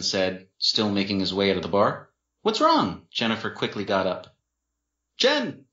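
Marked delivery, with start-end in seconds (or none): 2.4-3.1 high, urgent question; alarmed question. A narrator's reading. Sister reacting to bad news, alarmed. 5.2-5.6 high, startled cry of a name; exclaimed. A narrator's reading. Boyfriend falling as she gets up, exclaiming. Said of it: "Dean exclaimed".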